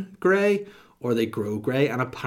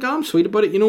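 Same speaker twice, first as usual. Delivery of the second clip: mock breezy; 10 semitones higher, 8 dB louder, faster than usual. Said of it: "I think I'm like".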